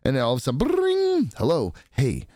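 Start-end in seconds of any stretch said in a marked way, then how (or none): 0.6-1.3 high imitated phone ring. Phone rings. 1.4-2.2 a slightly urgent caller voice; the future self calling his past self; future Greg calling. Retold or imitated. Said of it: "This is Greg from the future".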